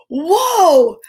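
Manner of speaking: high gasped mock-amazed whoa; gasp, then let it out in playful awe. Host revealing a vitamin to kids, with a high gasping whoa.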